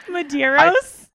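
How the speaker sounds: loud disbelieving Medeiros; shocked. Real, not acted. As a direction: gasp out the name in disbelief. Shocked at a hard name.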